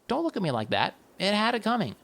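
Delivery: plain reading voice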